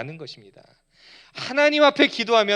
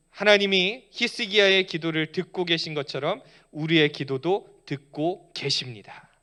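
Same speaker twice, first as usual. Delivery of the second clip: normal talking voice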